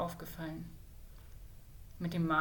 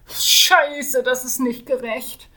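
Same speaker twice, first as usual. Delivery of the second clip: higher, much louder curse at his reflection; outburst; 5 semitones higher, 12 dB louder, much slower than usual. Acted. The mage seeing his scar in the mirror, shouting. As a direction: cry out in dismay. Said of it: "stöhnte leise".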